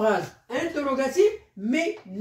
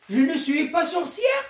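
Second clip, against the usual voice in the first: "sorcière" rising high into a question; rising question tune; 7 semitones higher than usual. Shown as a demonstration. Teacher showing pupils a question marked by intonation, rising. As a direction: make "Je ne suis pas sorcière" a question by a high rise on "sorcière". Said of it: "avec l'intonation".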